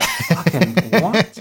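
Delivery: slow, baffled mock disbelief; incredulous casting agent. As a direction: stretch it out as if stunned by the pitch. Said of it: "you'd be like".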